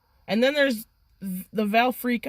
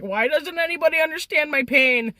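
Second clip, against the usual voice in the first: higher-pitched retold wail of exasperation; plaintive mock-upset complaint, pitched up; 6 semitones higher than usual. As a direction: lament it in a raised, put-upon voice. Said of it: "I'm pretty upset at this point".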